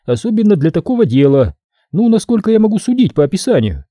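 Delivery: a businesslike woman explaining the job; woman worker. Acted. Sophie voiced by narrator.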